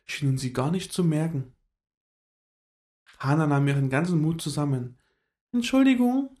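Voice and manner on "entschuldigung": polite, high little squirrel voice; a small creature asking politely; höflich. A narrator's reading. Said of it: "sagte sie höflich". (höflich, hohe Eichhörnchenstimme)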